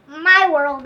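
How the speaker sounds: usual speaking voice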